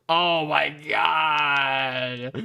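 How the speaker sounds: loud, slow, drawn-out gasp of disbelief; shocked oh my God. Retold or imitated. Friend sees the screenshots, loud drawn-out shocked cry. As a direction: stretch it out, stunned and loud.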